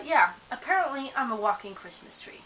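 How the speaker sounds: ordinary talk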